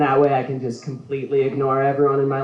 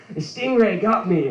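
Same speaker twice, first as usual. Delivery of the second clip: Steve Irwin impression; 6 semitones higher than usual. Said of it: "the funny voice".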